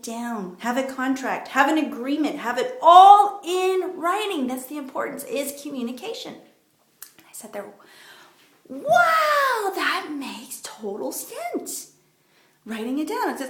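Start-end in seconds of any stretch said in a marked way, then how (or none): 2.4-4.5 higher, louder and slow on Have it all in writing; emphatic advice. Real, not acted. Key advice, driven home. 8.7-11.9 struck by the idea, she lets it sink in; amazed wow. Retold or imitated. Her reaction to the advice.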